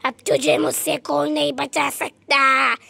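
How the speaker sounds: loud then deep witch voice; witch threat. Acted. Witch cornering the child, loud deep threat. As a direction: menace the trapped child.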